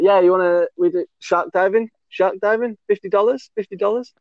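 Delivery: high-pitched eager hawker; retold sales patter, questions lifting high; local tout's pitch. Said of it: "I'm not gonna do his accent".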